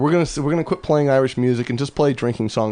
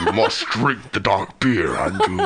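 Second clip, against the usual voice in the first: slow, laughing mock Sith temptation; dark side tempter; 4 semitones lower, slower than usual. Co-host playfully tempts Andrew to drink the dark beer, slow, laughing mock villain. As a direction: drawl it out like a villain luring him in.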